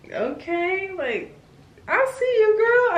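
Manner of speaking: very high sing-song praise; squeaky high I see you girl; hyping herself. Retold or imitated. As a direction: cheer yourself on playfully. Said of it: "you're just like".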